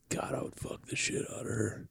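breathy, hushed mock leering; hushed under-the-breath lusting; lustful whisper. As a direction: whisper it like a drooling onlooker. Imagined lustful guy reacting to woman.

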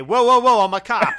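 loud, high halt-everyone call; mock alarmed shout. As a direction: break up a fight, hammed up. Acting out stopping a fight.

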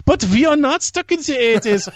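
high, indignant mock German accent; hammed-up offended German singer. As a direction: protest in a comic German accent. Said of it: "my only German accent I can do".

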